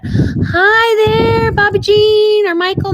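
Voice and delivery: high excited greeting. Real, not acted. Greeting viewers on live stream.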